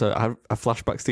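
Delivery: usual speaking voice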